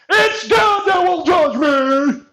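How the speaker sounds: hammed-up sanctimony; mock pious. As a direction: parody a smug believer. Mocking pious victim.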